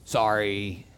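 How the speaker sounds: low, drawn-out "oh", then a small sorry; sheepish apology. Acted. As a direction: shrink back like someone caught out. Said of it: "I'm sure Job's like".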